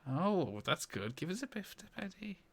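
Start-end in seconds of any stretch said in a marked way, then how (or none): 1.1-2.4 soft, high-pitched Scouse echo; imitated Scouse. Host copying the contestant's line, quiet mock Scouse. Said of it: "A Scouse accent".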